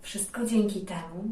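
ordinary delivery

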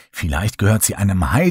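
ordinary delivery

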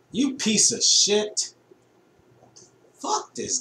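slow, loud high yell at the game; gamer rage. Real, not acted. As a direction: scream it at the screen. Raging at the game.

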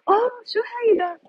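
high, quick startled question; surprised. Real, not acted. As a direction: blurt it out in amazement. Spots something surprising on the street.

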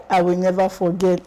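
ordinary delivery

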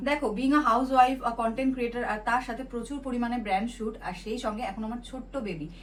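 bright, steady sponsored product pitch; ad read. Acted. Vlogger pitching face wash to viewers, upbeat ad read. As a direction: sell the face wash straight to camera.

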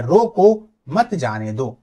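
slow, loud रोको, then मत जाने दो; pause after रोको. Shown as a demonstration. Teacher shows where comma is, pausing after रोको. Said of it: "यहां पर ये जो कॉमा लगा है".

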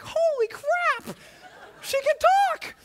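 very high, loud cry of disbelief; stunned amazement. Retold or imitated. Classmates reacting to the girl's performance, stunned amazement. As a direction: blurt it out, astonished. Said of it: "We're all just stunned".

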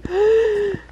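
sharp high gasp; frightened gasp. Real, not acted. Gasp heard.

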